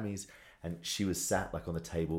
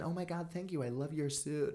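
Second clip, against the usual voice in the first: put-on breezy American accent, pitched up; 8 semitones higher, 5 dB quieter than usual. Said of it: "That was my American accent there".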